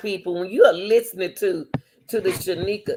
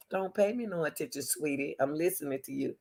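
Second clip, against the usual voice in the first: soft soothing; 3 semitones lower, 9 dB quieter than usual. Pretend. Soothing the man.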